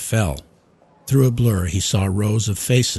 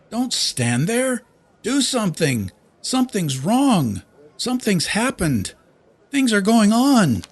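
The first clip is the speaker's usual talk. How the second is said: frantic shouting. A narrator's reading. Loyce shouting frantically at his staff, high-pitched. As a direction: yell as if nobody hears you. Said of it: "Loyce shouted at them frantically".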